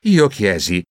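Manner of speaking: ordinary delivery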